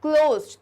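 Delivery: "क्लोज्ड" called out loudly, cl onset punched; teacher loudly stressing the cl in "क्लोज्ड"; stressed cl onset. Shown as a demonstration. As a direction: hit the cl of "क्लोज्ड" hard and loud. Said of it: "तो क्लो का sound आ रहा है".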